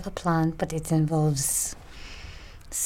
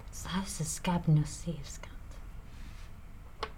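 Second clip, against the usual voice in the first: slow soft whisper; 11 dB quieter, much slower than usual. Acted. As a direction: whisper it slowly and secretly. Secret plotting question.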